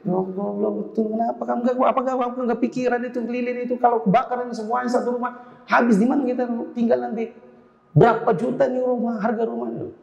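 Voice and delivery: high, berating parent voice; ranting parent. Acted. Parent berating a child after a candle fire, high.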